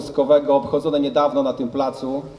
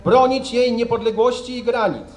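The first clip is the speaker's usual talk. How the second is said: formal oath reading; 4 semitones higher than usual. Real, not acted. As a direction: dictate the oath solemnly for troops. Officer reads oath for repeating. (uroczyste odczytywanie przysięgi)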